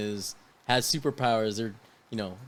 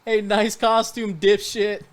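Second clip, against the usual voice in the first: higher, slow sneering call; mocking heckle; 9 semitones higher, 6 dB louder, slower than usual. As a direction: heckle him from a block away. Quoting mocking passerby.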